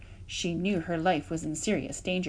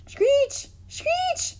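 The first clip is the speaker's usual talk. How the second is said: very high screeching cry; bird screech; 5 dB louder than usual. A narrator's reading. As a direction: screech like an angry robin. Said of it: "said the robin".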